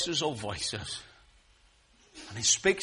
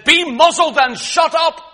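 high, shouted rebuke; forceful command; 14 semitones higher, 14 dB louder than usual. Acted. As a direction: thunder it at the storm. Jesus silencing the sea, acted.

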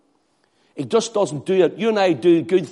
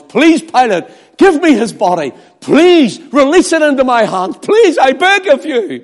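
higher and louder, pleading harder each line; desperate begging; 7 semitones higher, 4 dB louder than usual. Acted. Joseph begging Pilate for the body, rising louder pleading. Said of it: "It was".

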